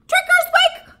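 high, loud squeaky yell; hamster shout. A narrator's reading. Hamster springs out under a cloth at the party, shouting high and loud. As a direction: belt it out at the top of your lungs. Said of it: "began to shout like I had never shouted".

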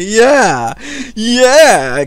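hammed-up proud parent; cheering dad. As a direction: play a dad loudly egging on his daughter. Imagined proud dad cheering daughter on.

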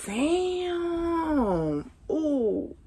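breathy high savoring damn. Retold or imitated. Tasting crawfish, she savors it with a high, soft damn. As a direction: taste the crawfish and melt. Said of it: "I'm like".

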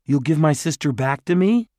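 high, loud, hopeful question; anxious. A narrator's reading. Jared bargaining for his sister, anxious. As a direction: press him to keep his word.

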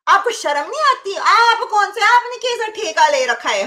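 high-pitched indignant reproach; indignant scolding. Pretend. Streamer scolds the viewer who accused her, mock indignation.

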